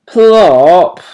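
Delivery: loud high call of the name. A narrator's reading. Mother owl calling her son, loud and high. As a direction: call him sharply from the nest. Mother calls owlet awake.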